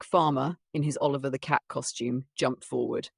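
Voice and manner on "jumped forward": plain reading voice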